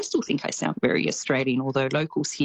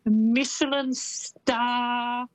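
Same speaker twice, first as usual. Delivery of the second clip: high, drawn-out grand announcement; hammed-up swanky delivery; mock-fancy; 7 semitones higher, much slower than usual. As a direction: play up being terribly sophisticated, slow and high. Playing up New Zealand's new sophistication.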